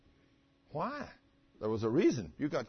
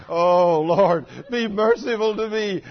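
loud, higher cry for mercy; desperate plea; 5 semitones higher, 10 dB louder, slower than usual. Retold or imitated. Preacher reenacting loud cry for mercy with bad knee. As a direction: cry out to the Lord.

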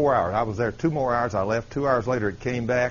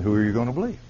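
normal talking voice

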